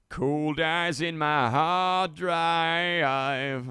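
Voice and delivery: theatrical slow growl of revenge; hammed-up menace. Shown as a demonstration. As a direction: stretch it like a movie villain. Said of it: "repeats the phrase twice".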